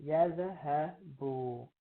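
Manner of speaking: Yazhabu spoken very slowly, each syllable ya-zha-bu held; patient teacher drawing out the word; very slow model pronunciation. Shown as a demonstration. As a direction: stretch Yazhabu out for students to hear. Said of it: "That's not actually pronounced".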